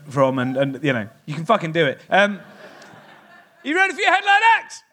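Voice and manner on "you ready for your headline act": high shouted hype. Real, not acted. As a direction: compere firing up the audience. Host hyping crowd.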